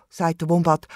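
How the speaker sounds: usual speaking voice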